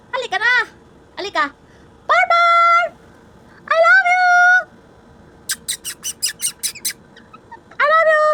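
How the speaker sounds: pet-talk shout; high-pitched calling. Real, not acted. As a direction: call the pet in a very high, loud sing-song.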